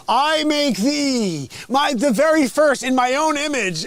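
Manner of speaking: high, loud, slow solemn proclamation; grand voice of God. Pretend. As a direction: proclaim like God creating man. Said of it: "God's like".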